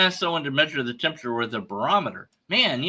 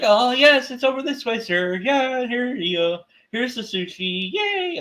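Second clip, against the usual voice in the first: eager employee; 9 semitones higher than usual. Retold or imitated. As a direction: gush like a delighted shop worker. Helpful store employee quoted.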